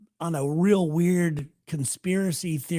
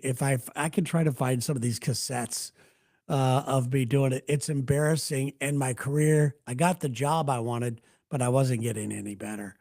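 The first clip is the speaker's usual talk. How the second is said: ordinary talk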